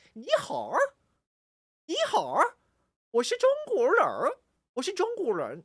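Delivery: high-pitched exaggerated mainland Mandarin; mainland Chinese accent. Shown as a demonstration. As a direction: play up the mainland China twang, pitched high. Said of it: "from China, it's, and I say the same things".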